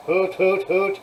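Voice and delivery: a light hoot hoot like a locomotive horn; mock train horn. Shown as a demonstration. Showing viewers the proto throttle horn with a light mock hoot. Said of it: "the horns up here like this".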